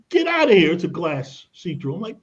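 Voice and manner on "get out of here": exasperated shout. Retold or imitated. Shooing receptionist from meeting.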